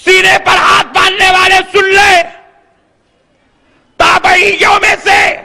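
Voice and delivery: high, drawn-out shouted demand; full-force preaching challenge. Acted. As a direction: call out the opponents before a crowd. (بلند آواز میں للکارتی خطابت)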